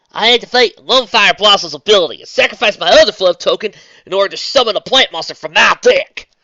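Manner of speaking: put-on country girl calling her move; Southern accent. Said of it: "doing a Southern voice for a duel".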